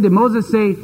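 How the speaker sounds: normal talking voice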